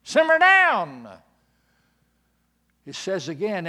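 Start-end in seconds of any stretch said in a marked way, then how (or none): 0.0-1.1 loud, high, slow scolding hush; rebuking crowd. Acted. Crowd rebuking the blind man, loud slow scolding. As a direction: scold him to settle down. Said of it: "rebuked him and said".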